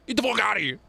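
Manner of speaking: teasing the chatter with an exaggerated brush-off; mock dismissal. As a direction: toss it off in a playful put-on voice. Repeats the dismissal, hammed up.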